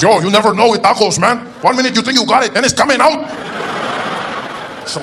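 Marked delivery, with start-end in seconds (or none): 0.0-3.2 high, loud, animated explaining; taco vendor. Acted. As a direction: call it out as if obvious. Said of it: "He says".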